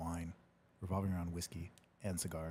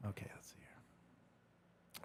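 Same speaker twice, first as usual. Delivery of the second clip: whisper; 16 dB quieter, much slower than usual. Real, not acted. Reading chat under breath.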